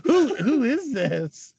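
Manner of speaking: laughing question. Retold or imitated. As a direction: asks who it is while still laughing. Retelling a confused phone question.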